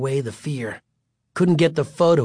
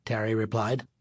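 usual speaking voice

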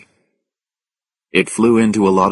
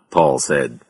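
usual speaking voice

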